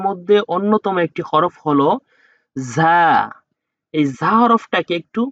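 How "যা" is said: ঝা with a forceful buzzing z; hard za. Shown as a demonstration. Teacher demonstrating a hard letter, ঝা said firmly. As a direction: push the first sound of ঝা out hard. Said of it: "ঝাড়ি দিয়ে বা শক্ত করে".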